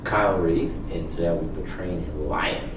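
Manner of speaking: normal talking voice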